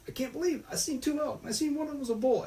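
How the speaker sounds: very high-pitched mimicry of a thrilled friend; imitating flipping out. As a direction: squeal it like he's going crazy. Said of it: "he's just flipping".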